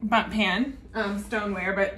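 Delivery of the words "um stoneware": ordinary talk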